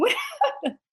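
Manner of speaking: laughing through the question. Retold or imitated. Retelling the punchline to his co-host, laughing. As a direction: breaks into a laugh on the repeat. Crowd asking, speaker laughing.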